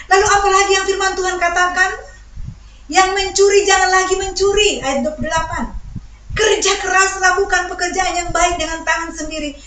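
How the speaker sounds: high, loud, insistent sermon voice; forceful preaching. Real, not acted. Preacher warns congregation against giving anger an opening, forceful preaching. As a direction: drive each question home to the congregation. Proclaims the scripture verse.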